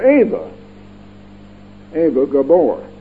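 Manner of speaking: "Ava" as AY-va; Eva with long A. Shown as a demonstration. Preacher naming Hollywood sister, "Ava" as AY-va. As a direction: say "Ava" with an ay sound. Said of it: "but they pronounce it A".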